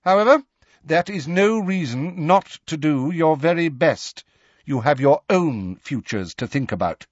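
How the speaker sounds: high, strict schoolmistress voice; McGonagall. Acted. Professor briefs class on exam rules, sternly. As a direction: lay down exam rules formally, pitched high.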